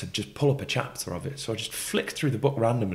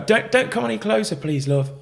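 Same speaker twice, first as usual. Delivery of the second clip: pleading; 13 semitones higher, 6 dB louder than usual. Retold or imitated. Pleading with approaching woman.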